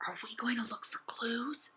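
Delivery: slow, hushed whisper; conspiratorial whisper, barely voiced. A narrator's reading. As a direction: lean in and whisper the question slowly. Secretive question about clues.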